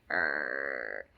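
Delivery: low growl imitating a grumpy dog. Imitating the poodle waking to the vet with a growl. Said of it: "he'd be like".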